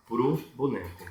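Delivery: ordinary delivery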